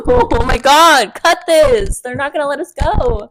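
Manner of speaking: loud, high, drawn-out mock alarm; mock panic. Joking to friends that the sponsor won't take them, mock panic.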